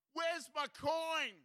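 high, drawn-out frantic wail; frantic cry. Acted. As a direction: cry out at your wit's end, stretching the words. Woman at her wit's end.

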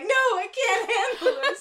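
mock-panicked wail with laughter underneath; high, loud comic meltdown; hammed-up panic. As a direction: imitate a friend freaking out, high and loud. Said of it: "like, hardcore panicking".

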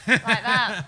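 ordinary delivery

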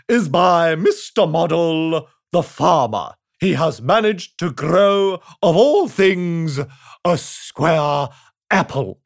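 slow, loud royal proclamation; pompous king. Acted. The king addressing the crowd, slow and pompous. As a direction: boom it out grandly and slowly. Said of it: "continued the king".

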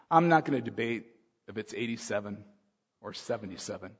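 usual speaking voice

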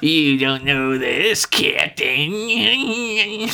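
slow mock villain, sliding up high at the end; hammed-up evil android, drawn out. Imitating the actor taunting the Captain, hammy evil voice. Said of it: "I'm doing my evil voice".

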